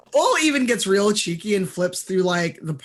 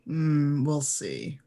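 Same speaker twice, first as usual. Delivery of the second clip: noncommittal; 3 semitones lower, 6 dB quieter, much slower than usual. Acted. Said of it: "He gives you kind of a".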